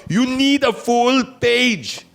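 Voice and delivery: high, slow mock African accent. Retelling the officer's demand for a full page, in a mock African accent. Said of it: "the African accent".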